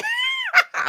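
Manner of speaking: real laughing shriek. Real, not acted. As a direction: let out a squealing laugh. Bursts out laughing.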